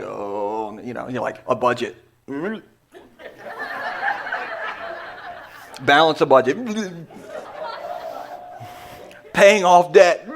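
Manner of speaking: low, halting mock nausea; mock queasy. Speaker mimicking a congregation sickened by budgeting, mock queasy. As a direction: say it as if about to throw up.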